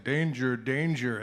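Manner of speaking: a hint of a recorded alarm announcement; automated voice. Automated voice warning at the downed bridge, lightly imitated. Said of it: "there was this automated voice like".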